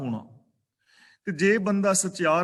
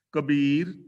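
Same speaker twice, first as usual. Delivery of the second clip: normal talking voice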